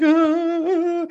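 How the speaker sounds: long, very high mock kid scream; imitated panicked falsetto wail; drawn-out squeal of a scared child; freaking-out scream. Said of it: "you're freaking out over an ant".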